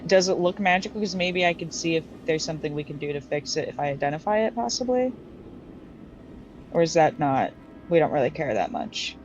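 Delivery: usual speaking voice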